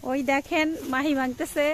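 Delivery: ordinary delivery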